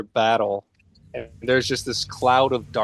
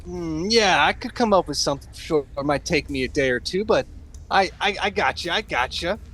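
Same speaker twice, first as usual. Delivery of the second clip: higher, quick halfling voice; jittery halfling; 6 semitones higher than usual. Acted. Halfling promises hero she can fix it, jittery. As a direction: agree in eager, stammered bursts.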